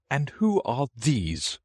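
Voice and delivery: high, slow royal demand; imperious Queen. A narrator's reading. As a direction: draw the question out in a high Queen voice. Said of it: "said the Queen".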